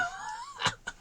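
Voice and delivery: a real laugh jumping high; high squeal of laughter. Real, not acted. Seller laughing at the buyer's cheek, high squeal.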